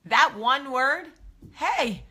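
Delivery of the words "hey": a higher, effortful hey; struggling hey. Retold or imitated. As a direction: dig deep to get the word out. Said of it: "reaching deep down into the depths of".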